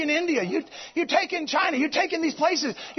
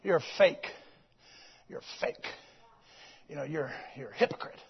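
very low, hushed then whispered fake; demon voice; 11 semitones lower, 9 dB quieter than usual. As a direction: hiss the taunt like a demon. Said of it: "clucking like a hen".

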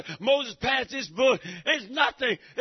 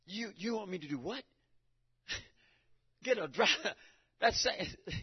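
hesitant, incredulous reply to God; Moses, lightly voiced and unsure; doubting Moses.

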